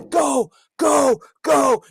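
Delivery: loud, high cheer. Retold or imitated. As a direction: yell it like a fan in the stands. Cheering a run in the retelling.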